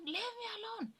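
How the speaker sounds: high-pitched mock yell, no real volume; retold shout. Woman chasing off worried housemates who knocked, high retold shout. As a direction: re-enact shouting in a high put-on voice. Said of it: "I would shout".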